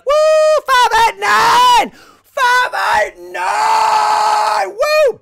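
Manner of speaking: very high, loud, slow mock hype chant; mock the hyped-up crowd shouting. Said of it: "And they're like".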